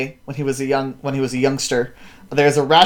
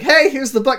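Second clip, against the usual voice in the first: high chirpy mock girl voice; lightly voiced cheerful girl; 10 semitones higher than usual. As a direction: call it out brightly, much higher. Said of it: "She's like".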